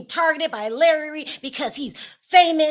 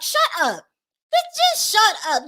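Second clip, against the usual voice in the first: high, louder "shut up" in disbelief; fed-up shut up; exasperated; 9 semitones higher, 4 dB louder than usual. Real, not acted. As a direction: tell him to stop, fed up. Exasperated at absurd defense.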